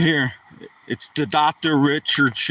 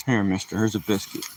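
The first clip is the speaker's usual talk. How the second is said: soft coaxing; 7 semitones lower, 7 dB quieter, faster than usual. Real, not acted. Offering the dog a biscuit.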